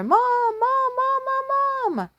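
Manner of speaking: high calls ringing out across the yard; calling out. Acted. Son in the front yard calling to his mother, high calling.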